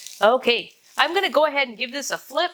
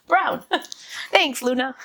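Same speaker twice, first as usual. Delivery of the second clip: laughing; 4 semitones higher than usual. Real, not acted. Laughing about dirty pants.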